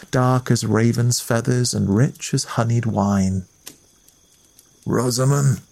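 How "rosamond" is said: a lightly voiced growling lord; growled. A narrator's reading. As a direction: growl the name from the bed. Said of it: "he growled".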